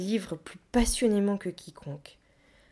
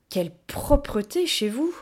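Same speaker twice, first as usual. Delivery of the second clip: louder, higher mock admiration; exclamation ironique; 5 semitones higher, 5 dB louder than usual. A narrator's reading. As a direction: exclaim with pointed irony. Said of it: "s'écria-t-il ironique". (s'exclamer avec ironie)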